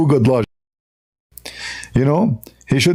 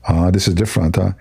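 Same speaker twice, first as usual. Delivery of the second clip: normal talking voice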